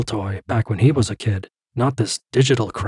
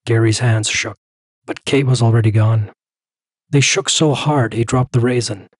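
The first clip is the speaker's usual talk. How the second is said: ordinary delivery, nothing marked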